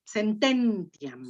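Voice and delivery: slow sententiam, stressed on TEN. Shown as a demonstration. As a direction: draw out sen-TEN-ti-am, leaning on TEN. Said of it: "tónica está en ten". (sententiam despacio, con acento en TEN)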